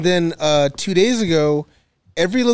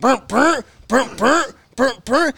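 burr burr machine sound; machine noise; 6 semitones higher, slower than usual. Retold or imitated. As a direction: imitate a stuttering motor. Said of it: "the cherry picker starts malfunctioning".

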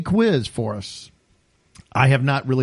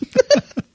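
sudden high squeal of a laugh; high burst of laughter; 4 dB louder than usual. Real, not acted. Host cracking up at naughty maps joke, high squealing laugh.

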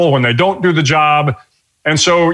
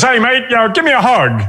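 higher-pitched imitation of a hearty Australian doctor; Australian accent; 8 semitones higher than usual. Australian doctor greeting a scared new patient, imitated Australian accent. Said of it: "still has a heavy Australian accent".

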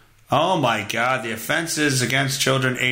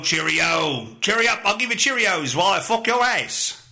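hammed-up, wobbly English accent; bad English accent; 4 semitones higher than usual. Comic impersonating a British criminal from the news, bad English accent. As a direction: put on a badly faked English accent. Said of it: "I can't do the English accent anymore".

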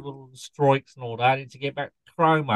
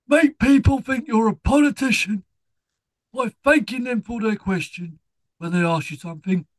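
Mike Reed impression; 7 semitones higher than usual. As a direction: give the tip up high as Mike Reed. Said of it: "Mike Reed here".

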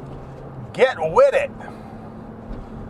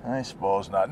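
much softer sarcastic mutter; quiet sarcastic grumble; muttered aside; 10 semitones lower, 10 dB quieter than usual. Real, not acted. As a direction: mutter it under your breath.

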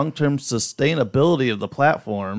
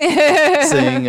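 laughing; 7 dB louder than usual. Real, not acted. Laughing while telling story.

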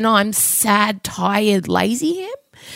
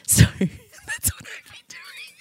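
laughing whisper; 8 dB quieter, much slower than usual. Real, not acted. Laughing wrap-up.

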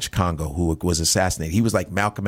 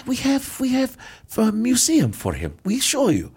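much higher, proud offer; proud Russian host; 14 semitones higher than usual. Retold or imitated. Russian host proudly telling the comedian about the museum. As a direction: play an eager high-voiced Russian guide.

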